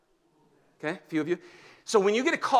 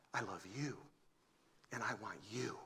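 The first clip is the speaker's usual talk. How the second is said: much softer and lower, tender; hushed, low loving whisper-soft voice; tender voice of Jesus; 7 semitones lower, 16 dB quieter than usual. Acted. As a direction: murmur it like a loving promise.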